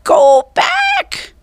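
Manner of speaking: very high parrot shriek; squawking parrot. A narrator's reading. Parrot Polly squawks at the children in the storm. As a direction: screech it like a parrot. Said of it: "squawked Polly".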